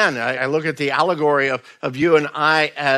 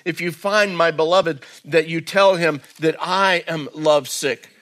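raised, earnest reading of the bride's words; dramatic Bible reading; scripture reading; 3 semitones higher than usual. Acted. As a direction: read it as her plea. Reads scripture passage dramatically.

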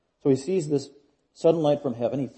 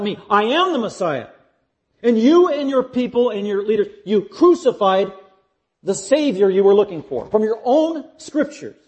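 raised, higher voice, building from rapid list to weighty charge; forceful confrontation; 6 semitones higher, 5 dB louder than usual. Acted. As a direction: drive every point home at the accused. Jesus voiced confronting Saul.

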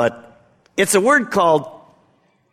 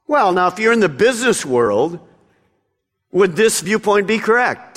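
ordinary talk, nothing marked

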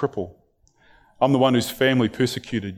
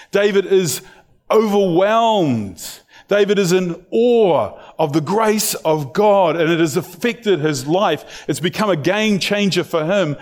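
building sermon; 5 semitones higher than usual. Acted. Preacher urging the congregation about God's grace, rising preaching. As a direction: lift the congregation toward awe as you preach.

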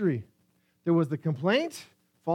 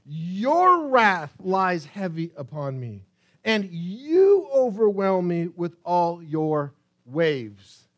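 solemn reading; 6 dB louder, much slower than usual. Acted. Reads verse seven slowly and heavily.

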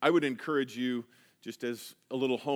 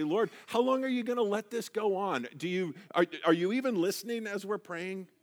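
higher, pleading plea to God; pleading prayer; 6 semitones higher than usual. Pretend. Believer praying to God about injustice, higher and pleading. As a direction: ask God as if tired of waiting. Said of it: "in our prayers sometimes we say".

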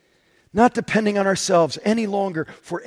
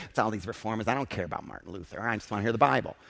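a lower, softer grumbling churchgoer; mock skeptical listener; 10 semitones lower, 11 dB quieter, much faster than usual. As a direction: mumble it low like a bored skeptic in the pew. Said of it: "Some of you may be sitting there".

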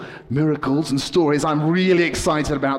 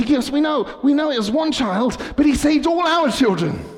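higher, louder insistent parents; pleading parents; 12 semitones higher, 4 dB louder than usual. Acted. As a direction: protest as the grateful parents. Parents answering the police.

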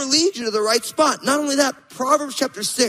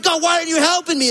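mock frustrated plea; 7 semitones higher, faster than usual. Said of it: "And you're like".

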